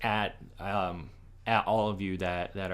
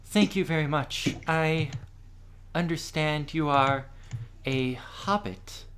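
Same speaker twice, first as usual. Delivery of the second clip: lightly voiced queen, higher and measured; respectful queen; 6 semitones higher, 3 dB louder than usual. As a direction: address a stranger politely, pausing between phrases. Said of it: "nods her head respectfully towards you and says".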